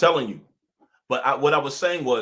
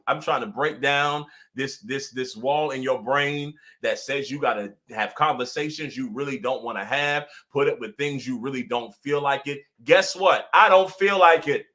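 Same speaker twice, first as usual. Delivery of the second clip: normal talking voice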